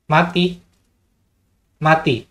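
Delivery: testing the Mati command on the module. Shown as a demonstration. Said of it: "Coba tes mati".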